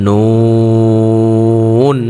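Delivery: নুন drawn out slowly with rounded lips; noon with rounded lips. Shown as a demonstration. Teacher showing students a rounded নুন. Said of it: "আমরা ঠোঁটগুলোকে গোল করব".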